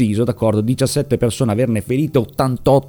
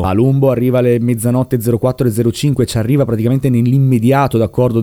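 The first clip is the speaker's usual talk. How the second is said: ordinary talk, nothing marked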